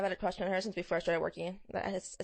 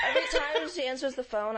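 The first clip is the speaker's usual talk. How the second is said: ordinary talk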